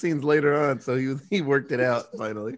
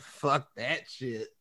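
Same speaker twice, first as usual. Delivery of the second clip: slow, much softer dismissal; mock cop shrug; 3 semitones lower, 9 dB quieter, much slower than usual. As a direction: let it go under your breath, slowly. Voicing the cop looking away.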